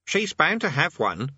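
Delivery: put-on high, self-satisfied boy's voice; smug. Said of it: "said Eric smugly".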